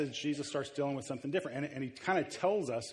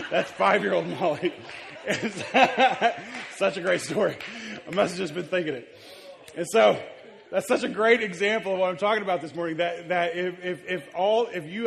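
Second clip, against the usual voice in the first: louder, higher speech through laughter; laughing while talking; 5 semitones higher, 9 dB louder than usual. Real, not acted. Pastor still laughing as he tells the church, louder and higher. Cracking up after the story.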